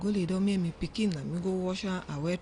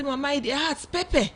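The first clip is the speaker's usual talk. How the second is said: higher child's complaint, lightly mocked; a hint of a hurting toddler, rising high; imitating small child; 7 semitones higher, 3 dB louder than usual. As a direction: voice the little girl saying it burns. Mother voices hurt small daughter.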